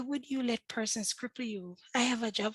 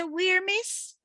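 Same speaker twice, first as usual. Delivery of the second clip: incredulous question; 12 semitones higher, 7 dB louder than usual. Retold or imitated. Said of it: "Me say".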